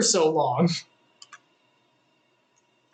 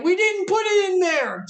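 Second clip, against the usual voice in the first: much higher, defensive whine; whiny protesting sons; 13 semitones higher than usual. Acted. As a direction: plead innocence like caught kids. Sons protest to angry father.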